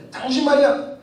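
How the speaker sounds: high, indignant challenge; confronting. Retold or imitated. Angry pastor confronting the preacher at the break, pitched high. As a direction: get in his face with it. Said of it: "하면서 막 대드는 거예요".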